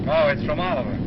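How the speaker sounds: normal talking voice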